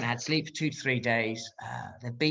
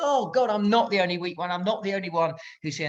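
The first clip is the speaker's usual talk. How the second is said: high-pitched rush of acted relief; gushing, higher inner voice; relieved thought; 7 semitones higher than usual. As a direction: act out giddy relief at having company.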